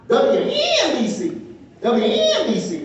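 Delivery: "W N B C" slow, with a high-pitched "N"; movie pronunciation drill. Retold or imitated. Recalling Howard Stern practicing the call letters, exaggerated high "N" in "W N B C". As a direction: stretch "W N B C", leaping up on the "N". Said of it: "trying to get the name right".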